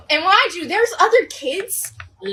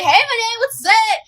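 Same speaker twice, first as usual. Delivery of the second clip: higher, louder imitation of a friend; mock friendly greeting; 6 semitones higher, 4 dB louder than usual. Friend runs up to the teller before school, mock friendly greeting.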